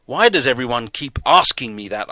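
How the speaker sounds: loud, desperate outburst; crying out. A narrator's reading. Stranger crying out at the doctor, loud and desperate. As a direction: cry out in frustration. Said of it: "I cried".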